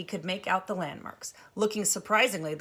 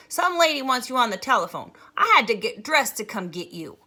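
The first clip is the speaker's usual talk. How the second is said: loud call; 5 semitones higher, 9 dB louder than usual. Acted. Maybelle calling Jess.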